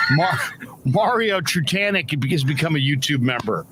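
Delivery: ordinary talk, nothing marked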